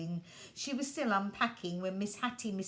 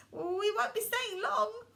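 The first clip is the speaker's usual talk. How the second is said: put-on high, polite little-boy voice; boy apologising as he falls; 12 semitones higher, 4 dB louder than usual. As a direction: mind your manners. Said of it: "remembering his manners".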